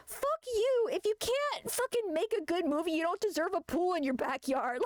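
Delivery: hammed-up fury at Rob Schneider; mock furious retort. Streamer ranting at Rob Schneider, high mock fury. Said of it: "I'm like".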